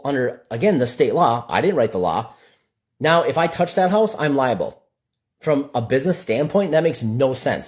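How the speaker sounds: ordinary delivery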